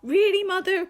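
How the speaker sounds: put-on high, loud teen-style protest to mum; mock-incredulous. Child answering mum's guilt trip, loud mock disbelief. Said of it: "you're just like".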